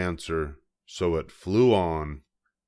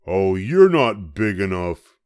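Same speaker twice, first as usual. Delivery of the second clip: grouchy ladybug; 7 dB louder than usual. A narrator's reading. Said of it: "said the grouchy ladybug".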